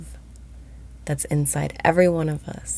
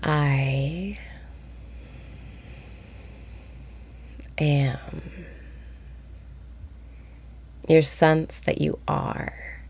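guided meditation. Acted. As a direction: ease listeners into calm, breath by breath. Leads a daily guided meditation.

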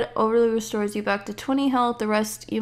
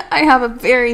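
ordinary delivery

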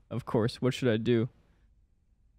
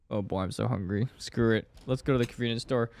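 ordinary delivery

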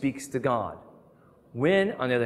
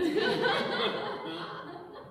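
a high rising laugh; 4 dB quieter than usual. Real, not acted. Laughing at four-year-old's crisis.

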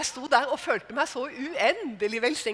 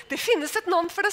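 getting worked up; 6 semitones higher, 4 dB louder than usual. Retold or imitated. Telling the stewardess off on the plane, rising heat. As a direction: voice rising higher and louder, heated. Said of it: "begynte å bli litt opphisset".

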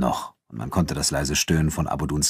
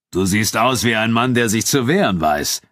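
projected, higher challenge; Abu Dun calling out; 4 semitones higher, 4 dB louder than usual. A narrator's reading. Nubian fighter provokes his armed friend, calling out. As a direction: provoke him from the stage. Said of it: "rief Abu Dun".

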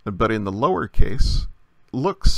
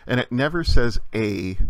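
usual speaking voice

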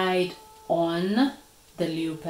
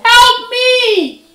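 very high, very loud yell for help; full-voiced pained shout; desperate cry; 15 dB louder than usual. A narrator's reading. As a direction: scream for rescue from the pit. Burning leopard cries for help.

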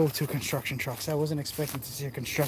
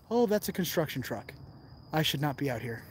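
ordinary delivery

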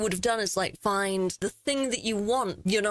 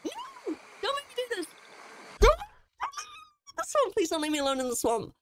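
pleading higher and fast, half-joking panic; real fright; faster than usual. Real, not acted. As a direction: beg not to be left alone, scared. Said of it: "I'm actually freaking out a little bit".

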